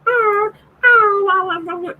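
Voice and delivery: high mock whimpering cry; hammed-up very high puppy wail; imitating a crying puppy. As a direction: whine like a shut-out puppy. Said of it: "Then he's crying".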